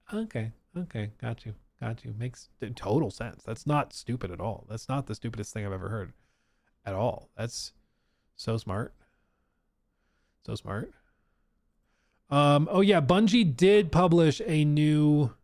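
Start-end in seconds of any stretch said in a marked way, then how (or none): 0.1-10.9 soft deadpan sarcasm; sarcastic. Acted. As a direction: praise it while meaning the opposite. Sarcastic praise of the phrase.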